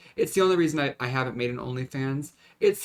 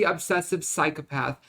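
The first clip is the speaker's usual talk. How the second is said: normal talking voice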